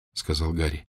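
usual speaking voice

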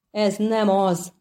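plain reading voice

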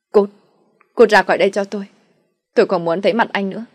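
loud furious shout, slightly fast at the end. Acted. Wife screams at her husband to get out, furious. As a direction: drive him out with fury.

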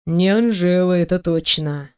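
low man's voice, wryly amused; amused smirk. A narrator's reading. Boss teasing the indignant employee, smirking. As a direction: drop low and agree with a smirk. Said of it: "усмехнулся шеф".

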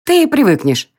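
high, pleased pixie voice; satisfied squeak. Acted. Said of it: "удовлетворённо пискнула Тень".

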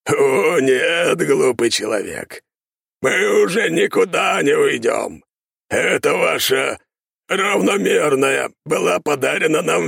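inhuman, slow, shouting monster; eldritch horror. A narrator's reading. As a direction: gloat like a demon. Monster mocks clan, shouting.

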